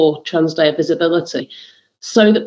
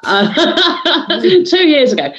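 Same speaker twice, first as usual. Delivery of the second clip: laughing it off, pitched up; 9 semitones higher, slower than usual. Real, not acted. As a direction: laugh through the words. Laughing at own date mix-up.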